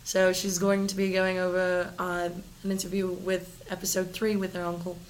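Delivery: put-on Australian segment intro; Australian accent. Introducing the next segment on request, Australian accent. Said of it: "Can you use that accent".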